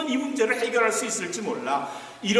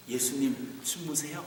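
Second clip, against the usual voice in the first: soft title read; 5 semitones lower, 9 dB quieter than usual. Shown as a demonstration. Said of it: "말씀의 제목을 이렇게 했어요".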